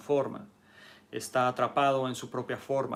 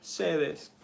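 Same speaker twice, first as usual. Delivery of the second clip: ordinary talk, nothing marked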